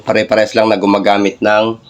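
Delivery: normal talking voice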